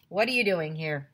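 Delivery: ordinary delivery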